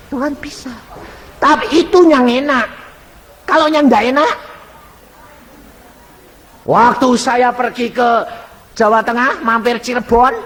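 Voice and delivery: high, loud pulpit voice; preaching. Acted. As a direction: lift the voice out over the congregation. Preacher raising his voice.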